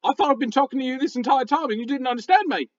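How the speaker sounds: high, squeaky gravelly critter voice; talking raccoon, bewildered. Acted. Raccoon finding out its friends understand it, high and bewildered.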